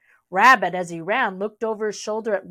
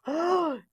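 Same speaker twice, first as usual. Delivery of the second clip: sharp gasp; 8 semitones higher, 4 dB quieter than usual. Pretend. Reader reacts to wolf.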